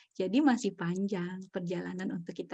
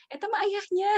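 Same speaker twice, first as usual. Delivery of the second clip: put-on much higher, playful kid voice pointing at dad; child blaming father; 13 semitones higher, 4 dB louder than usual. Retelling the child blaming the father, high. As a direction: squeak out the blame like a child.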